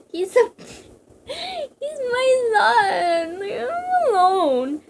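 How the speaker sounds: high, slow tearful wail; mock sobbing. A parent begging them to spare his son, mock sobbing.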